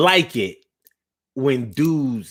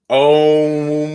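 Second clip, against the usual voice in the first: long held om chant; meditation om; 5 dB louder than usual. Shown as a demonstration. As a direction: intone it steadily. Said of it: "No, Siobhan".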